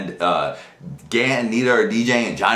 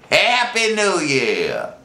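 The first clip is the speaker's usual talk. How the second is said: very slow, drawn-out happy new year; slurred party toast; drunken greeting; 4 semitones higher, much slower than usual. Shown as a demonstration. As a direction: stretch "Happy New Year" out, tipsy and dazed. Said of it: "That's all I could say".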